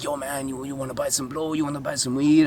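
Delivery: hushed street hustler pitch; beach kid dealer. Retold or imitated. Kid on the beach offering drugs, hushed pitch. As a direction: offer it furtively, slightly high. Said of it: "then this kid's like".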